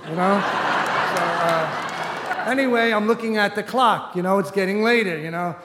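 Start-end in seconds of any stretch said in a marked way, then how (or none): none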